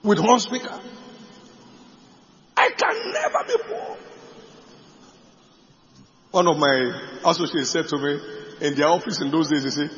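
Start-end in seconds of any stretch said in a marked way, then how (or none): 2.6-4.0 very high, strained preacher's scream; shrieked high proclamation; screamed declaration. Retold or imitated. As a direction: scream it like it's the only truth. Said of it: "I started screaming it".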